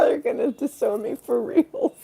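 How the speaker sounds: ordinary talk, nothing marked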